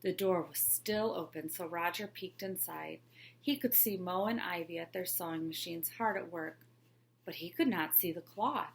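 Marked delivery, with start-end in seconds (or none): none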